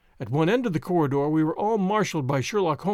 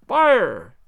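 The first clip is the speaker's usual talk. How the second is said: loud group yell of fire; shouting fire to flush him out; 4 semitones higher, 7 dB louder than usual. Acted. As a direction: call out fire at full voice. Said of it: "we all yelled".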